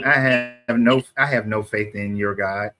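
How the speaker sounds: normal talking voice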